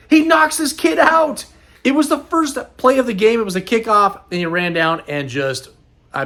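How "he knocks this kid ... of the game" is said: proud excitement. Real, not acted. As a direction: cheer your son's big hit. Proud dad describing son's hit.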